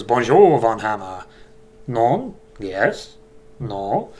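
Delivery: light mock French accent, lilting the questions; a shadow beast teasing in a hammy French accent. Shadow beast greeting Von Hammer at the door, in a light mock French accent. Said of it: "I'm gonna stop with the accent".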